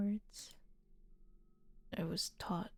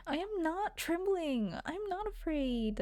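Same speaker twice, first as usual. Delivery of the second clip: whiny; 8 semitones higher than usual. Acted. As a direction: protest like a whining emo kid, pitch way up. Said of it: "I'm just gonna make him whiny".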